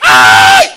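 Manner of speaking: sudden high loud "ay!" scream; hammed-up shriek of fright; yell. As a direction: mimic a startled dad's shriek. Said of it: "ואבא צועק".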